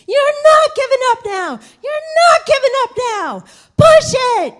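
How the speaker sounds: high, loud shout from the sideline; screaming coach. Retold or imitated. Coach screaming at his runner to push through. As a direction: bellow it over the crowd. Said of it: "screaming his lungs out".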